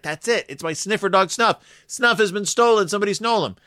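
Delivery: a hammed-up panicked dog owner; panicked Cousin Fred. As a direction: play the frantic owner crying theft.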